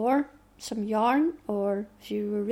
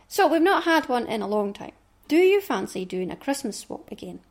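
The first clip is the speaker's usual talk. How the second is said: usual speaking voice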